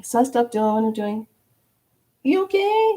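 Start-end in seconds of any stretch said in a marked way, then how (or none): 2.2-2.9 much higher, lightly voiced caring check-in; gentle concern. Checking on a lady nearby.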